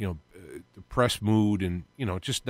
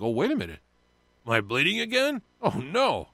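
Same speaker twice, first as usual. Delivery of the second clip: mock panic; 5 semitones higher than usual. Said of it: "I'm like".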